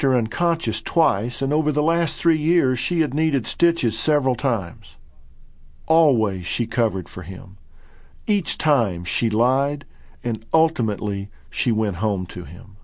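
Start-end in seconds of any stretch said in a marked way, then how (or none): none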